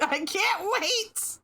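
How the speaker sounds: drawn-out squeal of fake glee; sarcastic excitement. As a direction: stretch it out, high and sarcastic. Sarcastic about annoying character.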